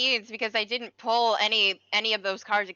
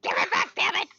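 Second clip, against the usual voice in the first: high mock protest; 9 semitones higher than usual. Friend joins in imitating the character, high mock protest. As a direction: protest up high without shouting.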